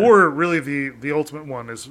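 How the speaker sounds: normal talking voice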